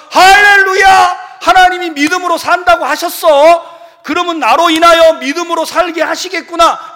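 high, loud shouted hallelujah of joy; joyful praise. Retold or imitated. Believer rejoicing at the sermon, loud joyful shout. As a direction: burst out rejoicing like you could dance. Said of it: "이러면 막 여러분 춤추겠죠".

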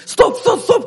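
high, loud panicked stop in the car; yelling stop. Retold or imitated. Husband yelling at his wife learning to drive, panicked shout. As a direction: shout it like the car's about to crash. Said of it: "왜 소리를 지르냐고".

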